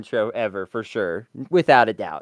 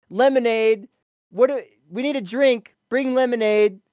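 higher, a lightly voiced spoiled rich family calling for lemonade; pompous rich family; 7 semitones higher than usual. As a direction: toss the order off like a spoiled heir. Voicing the rich family demanding lemonade.

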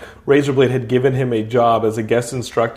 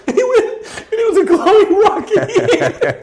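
words squeezed out through high, helpless laughter; can barely speak for laughing; laughing hard. Real, not acted. Still laughing telling the plot.

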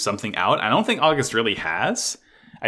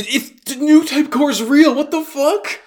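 shocked disbelief; 14 semitones higher, 6 dB louder than usual. Real, not acted. Shocked reaction to reveal.

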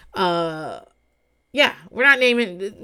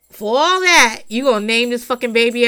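indignant outburst; 4 semitones higher, 7 dB louder than usual. Real, not acted. Ranting about naming baby after dad.

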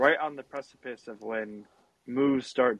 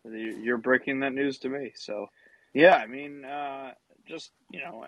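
ordinary delivery

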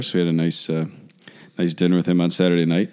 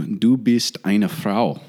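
slow, careful German pronunciation; German phrase; much slower than usual. Shown as a demonstration. Priest showing off his new German phrase, slow and deliberate. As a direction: spell out "Du bist eine Frau" slowly in German. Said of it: "That's what I wanna say".

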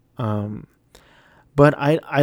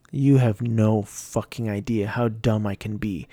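ordinary delivery

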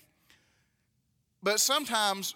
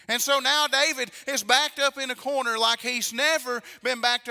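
raised, emphatic pulpit delivery; forceful preaching; 4 semitones higher, 5 dB louder than usual. Acted. Preacher tells congregation David is cornered, forceful preaching. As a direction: drive the danger home to the congregation.

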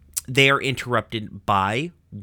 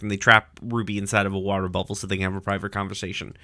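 normal talking voice

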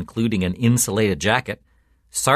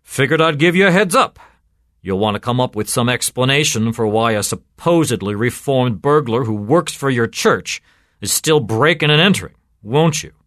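louder, goading cop with mock helpfulness; sardonic; 3 semitones higher, 6 dB louder than usual. A narrator's reading. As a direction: needle the priest, pushing the voice up. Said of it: "said Sergeant Benway sardonically".

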